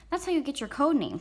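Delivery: put-on high-pitched kid explaining; proud little brother. A narrator's reading. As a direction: explain it eagerly as a small boy.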